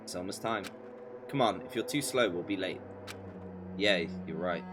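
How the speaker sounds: usual speaking voice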